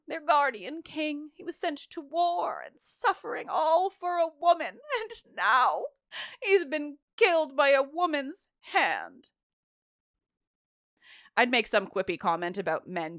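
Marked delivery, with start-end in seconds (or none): 0.1-9.3 hammed-up pitying wail for a fallen king; mock lament. Acted chorus lament, mocking.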